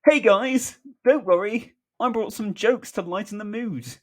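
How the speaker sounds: high, loud, perky joker voice; hammed-up high-pitched greeting; Michael. A narrator's reading. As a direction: burst in eagerly to cheer everyone up. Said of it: "Michael, popping up behind them".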